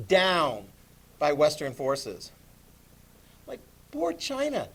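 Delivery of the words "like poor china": mock pity.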